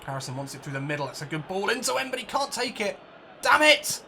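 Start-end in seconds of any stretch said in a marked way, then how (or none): 3.4-4.0 much higher, louder curse at the miss; frustrated shout. Real, not acted. Gamer cursing his player's poor touch, frustrated shout. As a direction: snap out the curse. Missed control.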